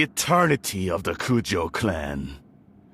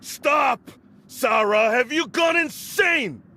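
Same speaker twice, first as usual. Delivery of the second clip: furious shout; 9 semitones higher than usual. Acted. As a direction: bellow at her in disbelief. Lord furious at disobedient Sara.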